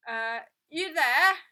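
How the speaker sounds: loud call from the back of the line. A narrator's reading. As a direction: shout to get someone's attention. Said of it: "called a voice from the back".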